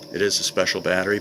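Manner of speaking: usual speaking voice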